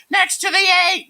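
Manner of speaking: very high, loud kid imitation; student voice. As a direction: squeal the answer like an eager pupil. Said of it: "but where else?".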